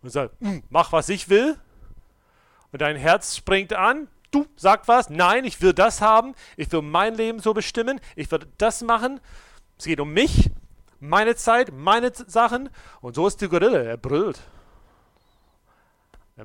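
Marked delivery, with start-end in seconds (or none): 0.4-1.6 louder, higher mock roar of a bully; roaring gorilla. Old self as gorilla bellowing demands at you, loud mock roar. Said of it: "sitzt neben dir und brüllt". 4.3-12.7 put-on loud, much higher childish demanding; selfish heart. Your leaping heart insisting on its own way, loud demanding.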